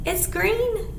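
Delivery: usual speaking voice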